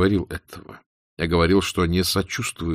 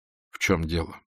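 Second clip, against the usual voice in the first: ordinary delivery, nothing marked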